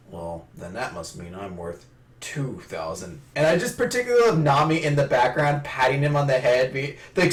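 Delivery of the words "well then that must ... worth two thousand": low, slow, quiet boast; put-on deep self-satisfied aside; Zoro, smug aside. Zoro boasts from the back, low smug aside.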